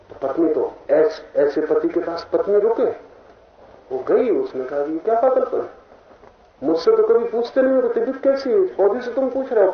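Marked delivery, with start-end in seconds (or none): 5.0-9.7 high-pitched mock reproach; imitated scolding wife. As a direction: complain like a neglected wife, pitch raised. Wife scolding husband, voiced. (पत्नी की शिकायत की नकल)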